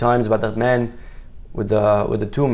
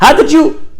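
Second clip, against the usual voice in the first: high, loud burst of upset disbelief; upset outburst; 10 dB louder than usual. Acted. Upset scientist demanding how someone knew, high loud outburst. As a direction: blurt it out much higher and louder. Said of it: "He's so upset".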